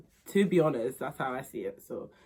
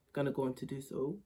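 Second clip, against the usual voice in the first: ordinary talk, nothing marked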